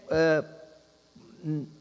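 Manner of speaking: ordinary talk, nothing marked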